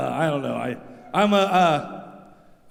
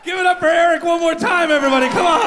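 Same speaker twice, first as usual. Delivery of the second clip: loud emcee hype; hype shout; 9 semitones higher, 6 dB louder, faster than usual. Real, not acted. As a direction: pump up the crowd. Host hypes the crowd.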